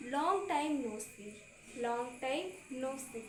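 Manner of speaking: very slow, each word laid out for learners; slow teaching read. Shown as a demonstration. Teacher reading an English phrase to students, very slow. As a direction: spell it out slowly so students can repeat. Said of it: "English में बोलेंगे".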